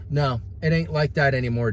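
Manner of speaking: ordinary talk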